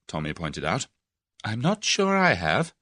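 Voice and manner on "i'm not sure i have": darkly. A narrator's reading. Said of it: "said Tuppence darkly".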